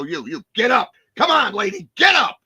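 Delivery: high, forceful shout at a hostage; barked order. Acted. Hostage taker yells at a lady on the floor, shouting. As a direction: shout an order to get up, building sharply.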